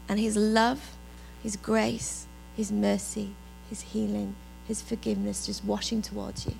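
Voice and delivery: low, measured worship reflection; hushed, unhurried devotional voice; reflective devotional. Real, not acted. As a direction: guide them gently into calm, leaving long pauses. Guides congregation in reflection.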